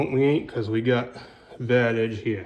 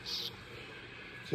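speaking with a pencil between teeth; muffled by a pencil held in mouth; 19 dB quieter than usual. Real, not acted. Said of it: "got a pencil in my mouth".

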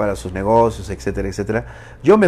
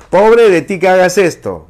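mock threat; 7 semitones higher, 7 dB louder than usual. Angry woman threatening quote.

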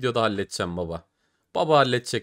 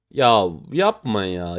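groan of dismay; 2 semitones lower, 6 dB louder, slower than usual. Real, not acted. Losing the first case.

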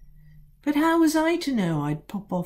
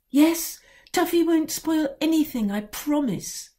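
eager promise; 11 semitones higher than usual. A narrator's reading. Ellie promises father.

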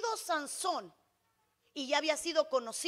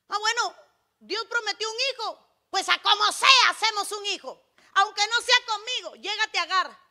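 loud, raised-pitch quoted wife; acted impulsive outburst; 4 semitones higher, 10 dB louder than usual. Sara pushing Abraham toward Agar, loud impulsive outburst. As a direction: blurt out a rash decision at full volume. Acts out Sara's rash decision.

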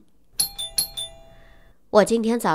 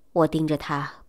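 ordinary delivery, nothing marked

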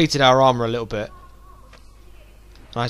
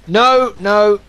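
panicked shout; 6 semitones higher, 6 dB louder, much slower than usual. Real, not acted. Wasted a grenade by mistake.

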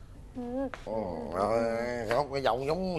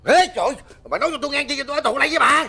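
panicked outburst; 8 semitones higher, 4 dB louder, faster than usual. Acted. Husband panics at wife's news.

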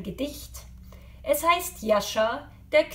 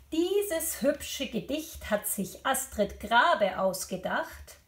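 plain reading voice

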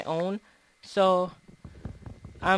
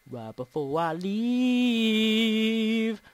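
very slow, LEAVE held extremely long; playful long-held note; 2 semitones higher, much slower than usual. Pretend. Player repeating the phrase before going, playful long-held note. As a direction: hold "leave" out like a sustained note.

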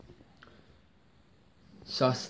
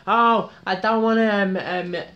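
whiny Bobby voice; 7 semitones higher than usual. Retold or imitated. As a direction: whine like an upset teen. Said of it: "he said".

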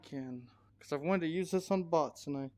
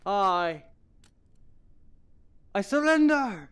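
shouting; 5 semitones higher, 11 dB louder, slower than usual. Pretend. Mock surrender while gaming.

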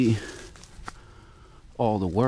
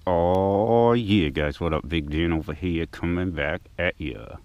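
talking around a blunt held in the lips; words shaped around a blunt in the mouth; 5 dB quieter than usual. Real, not acted. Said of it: "Talking with a blunt in my mouth".

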